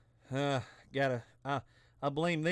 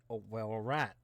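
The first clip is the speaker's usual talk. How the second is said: normal talking voice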